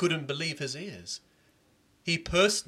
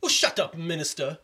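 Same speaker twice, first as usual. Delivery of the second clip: high, loud retort; annoyed crowd; 5 semitones higher, 7 dB louder than usual. Acted. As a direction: snap at the minister. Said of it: "everyone else said".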